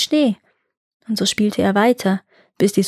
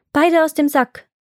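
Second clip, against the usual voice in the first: higher-pitched magic command; commanding call; 7 semitones higher than usual. A narrator's reading. Iwan orders the helpers from the sack, commanding call. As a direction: call it out firmly.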